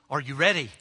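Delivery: normal talking voice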